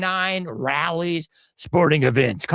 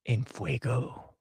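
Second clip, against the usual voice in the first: soft slow aside; 11 semitones lower, 11 dB quieter, slower than usual. Real, not acted. Playful aside on hot judge.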